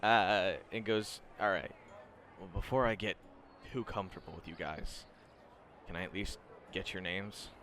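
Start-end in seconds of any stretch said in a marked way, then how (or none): 1.4-7.5 low, soft-spoken student; Shinso. Acted. Student asking the new group their names, low and soft. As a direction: keep it quiet and reserved. Game master voices Shinso.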